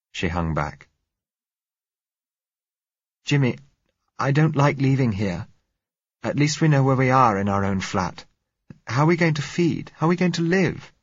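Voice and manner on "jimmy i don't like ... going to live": put-on high, worried girl's voice; anxious young woman. A narrator's reading. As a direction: plead with rising worry, pitched high. Frightened girl reluctant to leave flat.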